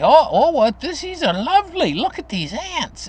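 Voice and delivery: put-on high, delighted old lady voice; sweet old grandma. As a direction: gush over the ants like a fond grandmother. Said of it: "She'd say".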